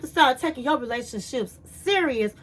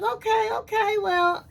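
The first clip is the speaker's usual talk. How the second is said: higher pitched flustered okay; man thrown by her answer, flustered and eager; flustered excitement; 8 semitones higher than usual. Retold or imitated. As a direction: caught off guard and eager. Man flustered after cuddle talk.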